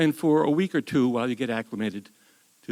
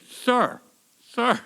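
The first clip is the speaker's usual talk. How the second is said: higher, louder call for attention; 10 semitones higher, 6 dB louder than usual. Retold or imitated. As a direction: flag down the colonel from the crowd. Soldier calling out to colonel.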